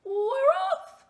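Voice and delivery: very high, loud crew cheer; the whole crew shouting together; pirates shouting. Acted. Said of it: "they all shouted".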